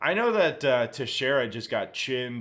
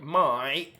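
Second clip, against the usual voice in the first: Aussie accent; 3 semitones higher than usual. Shown as a demonstration. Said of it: "I can say certain words in an Aussie voice".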